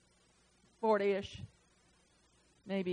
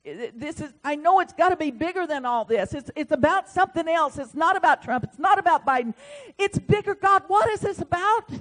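raised, pleading cry to God; loud pleading prayer; 7 semitones higher, 9 dB louder than usual. Retold or imitated. Preacher retelling his loud prayer to God. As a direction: call out to the Lord, begging to know.

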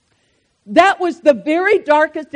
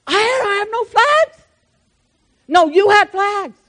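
acted back and forth; 9 semitones higher, 3 dB louder than usual. Acted flag quarrel.

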